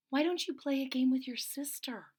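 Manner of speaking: usual speaking voice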